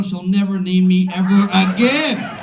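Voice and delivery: normal talking voice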